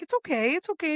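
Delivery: high, cooing comfort for a crying friend; soothing. Retold or imitated. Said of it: "like, really soothing her".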